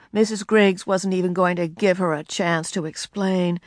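ordinary delivery, nothing marked

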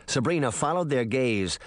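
plain reading voice

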